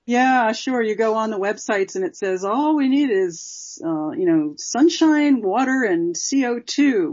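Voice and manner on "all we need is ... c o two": high, drawn-out parody of a promotional pitch; mock hype. Guest quoting algae websites to the interviewer, mock hype. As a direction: imitate breezy website promises, pitched well up.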